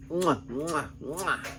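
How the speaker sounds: smacked kiss noises; chef's kiss. Real, not acted. Fan raving about an awesome movie, with exaggerated kiss sounds. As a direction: punctuate the praise with lip-smacking kisses.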